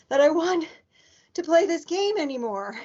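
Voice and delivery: panting after the chase; out of breath. A narrator's reading. Peter escaped the lion upstairs, out of breath. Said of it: "between gasps of air".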